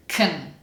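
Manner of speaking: KUN with no vowel, k straight to n; reduced can. Shown as a demonstration. Teacher shows learners reduced can, vowel cut out. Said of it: "what does can sound like when it's reduced".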